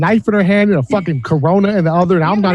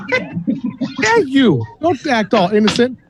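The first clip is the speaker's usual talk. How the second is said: ordinary talk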